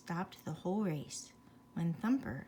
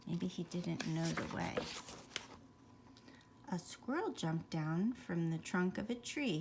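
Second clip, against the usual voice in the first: ordinary delivery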